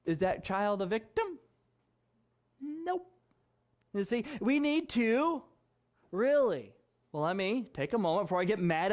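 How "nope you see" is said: usual speaking voice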